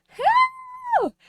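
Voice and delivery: very high, loud mock cry for help; retold shout. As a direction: yell it high like a panicked call. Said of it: "and I went".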